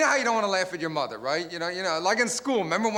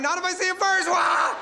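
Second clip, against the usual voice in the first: mock sitcom punchline; 11 semitones higher, 4 dB louder than usual. Sitcom line plus canned laugh.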